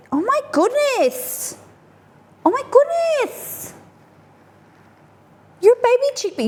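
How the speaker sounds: squealing at the tiny chickpeas; amazed squeal. Real, not acted. Cook discovers chana dal are baby chickpeas, squealing in amazement.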